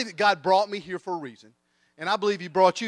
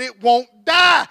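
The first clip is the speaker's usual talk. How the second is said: high, loud and drawn-out; exasperated mock yell; 7 semitones higher, 12 dB louder, much slower than usual. Shooter explains to neighbors about the hog, desperate drawn-out yell. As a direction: stretch it out in hammed-up desperation.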